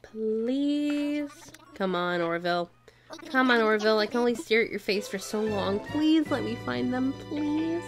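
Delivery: normal talking voice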